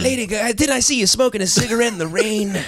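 [name]. Retold or imitated. Second comic as the singer addressing his partner, old crooner voice. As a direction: muse like a confused old singer.

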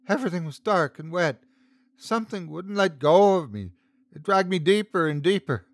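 usual speaking voice

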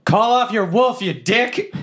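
high-pitched angry holler; yell. Acted. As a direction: holler it as a demand across a fight. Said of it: "And I yell".

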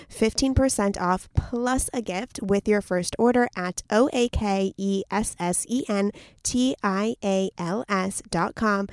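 slow, spelled letter by letter; spelled out slowly. Shown as a demonstration. Host spelling the website for listeners, slow and clear. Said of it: "spelling out website slowly".

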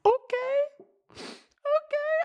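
tiny high voice choking up; very high, tearful attempt at agreeing; emotional squeak. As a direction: squeak it out as if about to cry. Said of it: "I'm actually getting emotional".

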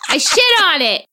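high imitation of a kid, "shit" meant as spit; proud little-kid voice, "shit" standing in for spit; toddler mispronunciation. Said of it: "if he's saying spit or shit".

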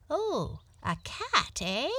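high, curious rabbit exclamation; rabbit exclaiming. A narrator's reading. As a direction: start high on a surprised little "oh".

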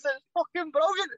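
squeaky, thrilled wee pal; excited teammate. Retold or imitated. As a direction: impersonate a buzzing teammate who can't believe it. Teammate raving about the goal.

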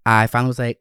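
ordinary talk, nothing marked

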